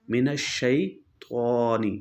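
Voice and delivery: मिनश्शैतानी drawn out slowly, shin doubled, zabar on the nun; slow tajweed recitation. Shown as a demonstration. Quran teacher demonstrating to students, slow drawn-out recitation. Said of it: "तो अब बन गया".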